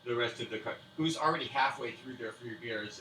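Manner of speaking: usual speaking voice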